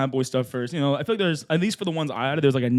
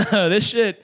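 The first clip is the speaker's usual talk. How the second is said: normal talking voice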